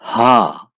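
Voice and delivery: हा, said loud with a long aa; German H as haa. Shown as a demonstration. Teaching learners the German H, loud clear letter. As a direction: call out हा, the German H. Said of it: "हा बोलेंगे".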